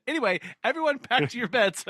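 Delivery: fast, high, breaking into laughter; laughing through it. Retold or imitated. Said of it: "And he's like".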